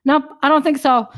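loud, high mock refusal; hammed-up stubborn no. Retold stubborn refusal to advice.

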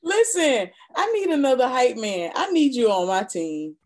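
loud, high, excited praise. Real, not acted. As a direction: hype back at the host. Guest responds to hype.

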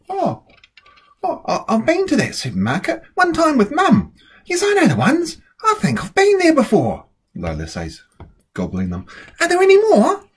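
excited Lola. A narrator's reading. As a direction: bubble over with delight.

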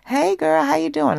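put-on high, loud, sing-song "hey girl"; sugary high greeting. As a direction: chirp it like a fake-friendly ghoster. Ghoster returns and greets her.

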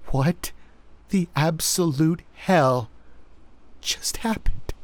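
frightened, voice trembling up high; stunned and scared, barely holding it; shaky voice. A narrator's reading. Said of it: "her voice shaky".

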